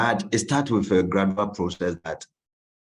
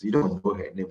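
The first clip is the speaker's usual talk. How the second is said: ordinary delivery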